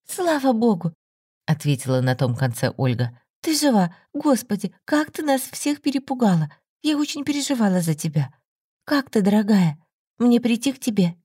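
a friend who feared the worst; relieved friend. A narrator's reading. Olga relieved on phone.

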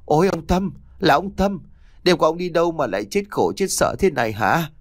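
keen and lament as you cry out his name; wailing loudly. A narrator's reading. A wife finds her husband's mangled body and wails loudly. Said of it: "mụ gào toáng lên".

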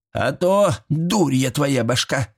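put-on high, drawn-out old-woman scolding; irate granny. A narrator's reading. Old woman explains to the dense chairman, irate. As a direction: scold the fool as a cranky granny.

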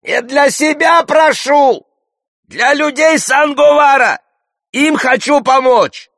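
high, loud, drawn-out shouting; straining to outshout. A narrator's reading. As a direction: shout over a noisy crowd, word by word. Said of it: "силится перекричать общий гайгуй". (надрывно перекрикивает общий гвалт)

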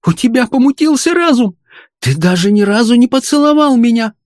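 put-on very high, girlish reproach; upset young fraulein. A narrator's reading. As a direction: exclaim in wounded disbelief. Alarmed girl reproaching her beloved.

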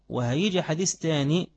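normal talking voice

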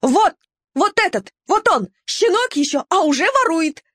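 high, eager squealing accusation; squealing tattletale. A narrator's reading. Vilka fawning to Neptun and pointing at the puppy, high squeals. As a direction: squeal it like a fawning little mongrel. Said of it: "Вилька юлила впереди и повизгивала".

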